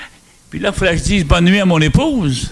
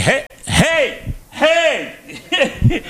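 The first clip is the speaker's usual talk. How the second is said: calling for attention; 10 semitones higher, 3 dB quieter, much slower than usual. Retold or imitated.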